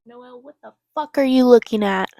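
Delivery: real irritated yell across the room; annoyed shout. Real, not acted. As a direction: snap loudly at someone nearby. Snapping at sister off camera.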